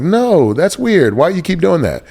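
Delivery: high-pitched imitation of kids; bemused objection. Kids call out dad's put-on voice, high bemused objection. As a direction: object in a high, puzzled kid's protest.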